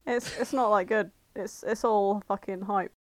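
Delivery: ordinary talk, nothing marked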